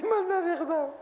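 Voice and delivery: mock denial. Mocking the denier.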